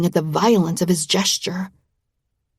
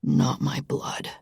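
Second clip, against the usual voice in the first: low, slow, hushed and cold; low quiet menace; 5 semitones lower, 7 dB quieter, slower than usual. A narrator's reading. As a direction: say it flat and dark, barely voiced. Dark reply to lover.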